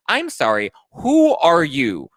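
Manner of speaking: loud, high, drawn-out challenge; indignant confrontation. Acted. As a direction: demand it, stretching the key word. Recapper mock-confronts the newcomer.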